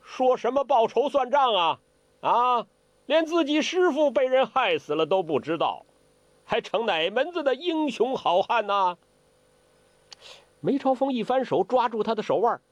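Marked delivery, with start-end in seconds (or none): 0.0-9.0 high, scornful jeering voice; taunting old martial-arts villain; mocking old villain. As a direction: sneer at them from on high. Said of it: "他说话了".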